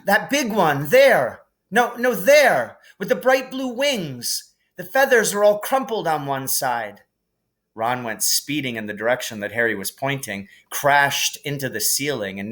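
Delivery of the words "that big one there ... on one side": excited calling out. A narrator's reading. Harry pointing out the key.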